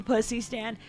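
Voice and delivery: ordinary delivery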